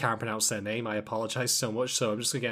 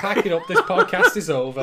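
mock outrage; 4 semitones higher, 8 dB louder than usual. Mock quitting after bad pun.

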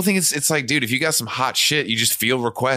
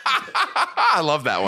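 laughing; 8 semitones higher, much slower than usual. Real, not acted. Laughing about favorite song.

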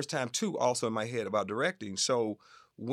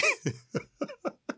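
very high-pitched laugh in an imitated voice; put-on high cackle after the line. Star's laugh ending the imitated phone message, high-pitched. Laugh capping the Eddie impression.

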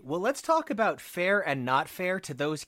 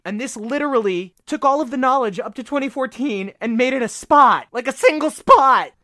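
indignant; 5 semitones higher, 8 dB louder than usual. Real, not acted. Indignant fan complaint.

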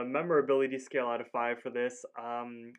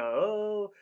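high, loud mock "ooh"; drawn-out, raised "oh"; deliberate "oh" catchphrase; 9 semitones higher, 6 dB louder than usual. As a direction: punch out a teasing cartoon "oh". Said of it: "he doesn't really say, like, a".

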